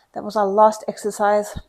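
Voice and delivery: breathless after the workout; panting between words; out of breath. Real, not acted. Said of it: "I'm out of breath".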